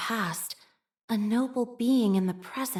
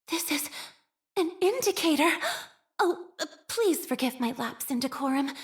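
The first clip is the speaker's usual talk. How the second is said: flustered fluster; 6 semitones higher than usual. Acted. Caught out, flustered.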